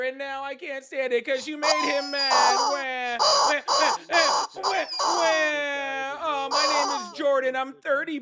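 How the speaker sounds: high fake wailing and whining, mock sobbing; mocking a caller as a whiny crying baby, high and hammed-up; mocking crybaby. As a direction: ham up a crybaby wailing over his boyfriend. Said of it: "this is what you sound like to me".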